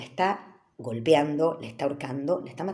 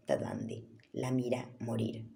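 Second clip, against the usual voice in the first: slow, low whisper; hushed; 7 semitones lower, 12 dB quieter than usual. Acted. Host telling how Bundy watched her die, whispered. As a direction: drop into a near-silent whisper.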